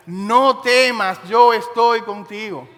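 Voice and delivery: loud, very slow, every word weighed; booming voice of God; the Lord's reassurance. Retold or imitated. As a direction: proclaim it slowly and loud to the congregation. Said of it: "te dice en esta hora".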